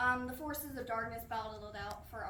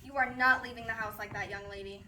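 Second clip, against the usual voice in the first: stern dad; 3 semitones lower, 4 dB louder than usual. Acted. Parent scolds teen daughter.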